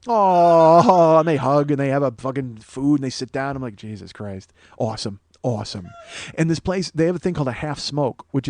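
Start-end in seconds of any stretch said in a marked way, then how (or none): 0.1-1.2 long, loud, drawn-out aww; mock touched coo. Comic reacting to brawlers suddenly hugging, mock-touched. 3.8-5.9 low, soft aside; understated amazement. Retold or imitated. Comic watching brawlers make up, quiet amazement. Muttered awe at the absurd scene.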